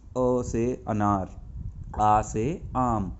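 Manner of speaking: each sound drawn out slowly; slow letter drill. Shown as a demonstration. Teacher drilling children, slow careful recitation. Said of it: "इसको ए नहीं बोलेंगे".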